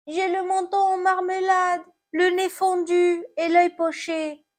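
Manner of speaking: high, plaintive apple voice; hurt apple. Acted. Fallen apple answers about its injuries, high lament.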